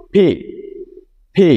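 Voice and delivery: P said clearly with a breathy "ফি" release; slow careful "ফি" for letter P; English letter P demo. Shown as a demonstration. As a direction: say "ফি" like the English letter P, not Bengali প. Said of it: "অনেকটা ক এর মতো হচ্ছে".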